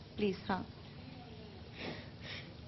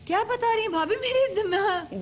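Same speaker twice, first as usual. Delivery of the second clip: real tears, voice rising high as she cries; crying; 7 semitones higher than usual. Real, not acted. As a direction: speak through genuine crying. Host breaks down hearing abuse.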